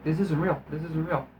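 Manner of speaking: soft, retreating mumble of disbelief; quiet repeated chant, fading away; imitated dazed muttering. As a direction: mimic someone muttering through a bad dream. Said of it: "like he's having a bad dream".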